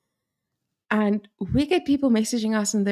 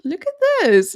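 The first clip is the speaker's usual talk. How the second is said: excited look at this; 9 semitones higher, 4 dB louder than usual. Retold or imitated. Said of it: "I was like".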